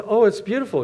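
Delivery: high delighted gush; mock-thrilled printer, high pitched; gushing praise. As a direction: gush over it like a fan. Printer gushing over song.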